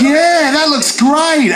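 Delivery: slow, high eager yeah; eager diner. Pretend. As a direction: drool over it slowly. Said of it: "And you'd be like".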